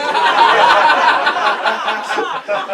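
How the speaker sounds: loud breathy burst of laughter; big airless laugh; embarrassed laugh. Real, not acted. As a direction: laugh it off sheepishly. Laughs at own admission.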